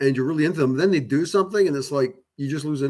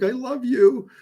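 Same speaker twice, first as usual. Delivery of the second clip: much higher, louder, blubbering "I love you"; mock weepy; 11 semitones higher, 4 dB louder, slower than usual. Retelling a friend's sobbing confession, mock weepy. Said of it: "He's, like, weeping".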